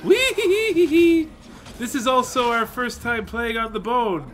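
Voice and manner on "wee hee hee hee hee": high slow ghost cackle; ghostly cackle. Pretend. Ghost laugh.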